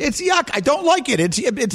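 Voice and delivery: high whiny protest. Real, not acted. Defending his dislike of soup.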